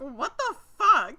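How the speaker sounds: exaggerated what-on-earth; baffled outburst. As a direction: act out total disbelief, half laughing. Acts out Rio's shocked reaction.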